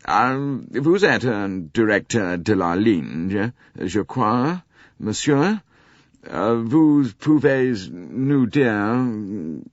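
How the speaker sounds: put-on slow, deliberate French with a British accent; careful British French. Arbuthnot addresses Poirot in careful British-accented French. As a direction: pick through the French like an Englishman. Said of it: "careful British French".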